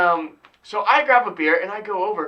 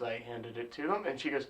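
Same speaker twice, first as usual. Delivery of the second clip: ordinary talk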